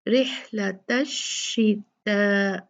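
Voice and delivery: रिहलत slow with a crisp throaty ह; slow rih-lata with a sharp ha; clear ha. Shown as a demonstration. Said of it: "हा को बहुत वाज़े पढ़ें".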